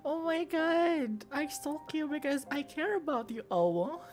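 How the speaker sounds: high cutesy voice. Acted. Creepy cute stalker line.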